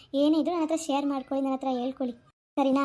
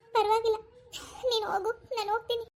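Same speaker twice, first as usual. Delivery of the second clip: tearful goodbye; 7 semitones higher than usual. Acted. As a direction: laugh through the tears as you send him off. Pavi parting tearfully from husband.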